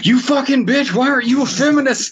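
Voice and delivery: high, fast screaming insult; mock furious shout. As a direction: shriek it out in a cartoon rage. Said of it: "in Stitch's voice".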